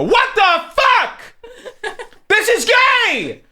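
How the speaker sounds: high, drawn-out shriek of outrage; shocked yell. Retold or imitated. Babysitter finding the kids in the bathtub, high shocked yell. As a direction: yell it way up high, mock horrified.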